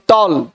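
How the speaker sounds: टॉल as one single syllable. Shown as a demonstration. Teacher showing a one-syllable word, टॉल in one go. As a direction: say टॉल in one unbroken beat. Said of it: "एक बार में".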